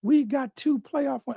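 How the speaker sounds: high, slow, syrupy fake gushing; drawn-out mock sentimental fan, high and slow; mocking sappy voice. Podcaster mocking a content fan, high slow gushing voice. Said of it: "I don't know why I'm doing that voice".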